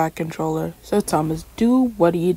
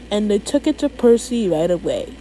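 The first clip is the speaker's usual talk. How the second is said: usual speaking voice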